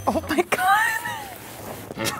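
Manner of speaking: normal talking voice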